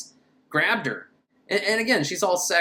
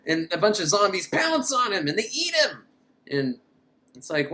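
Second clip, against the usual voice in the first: ordinary talk, nothing marked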